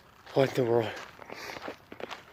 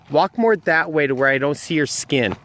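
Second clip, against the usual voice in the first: usual speaking voice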